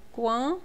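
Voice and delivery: quan with an audible w glide after the k; U sounded. Shown as a demonstration. Teacher isolates the syllable quan, U clearly sounded. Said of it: "eu escuto esse U".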